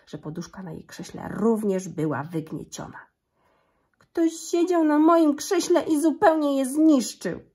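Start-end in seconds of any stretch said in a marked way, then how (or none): 4.0-7.4 put-on much higher, louder baby bear; cieniutki głosik. A narrator's reading. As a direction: squeal it high and loud like a little bear. (mały niedźwiadek woła wysoko i głośno)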